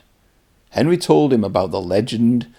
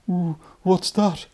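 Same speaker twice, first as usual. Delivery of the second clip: fearful; 9 semitones higher than usual. Acted. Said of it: "he asked fearfully".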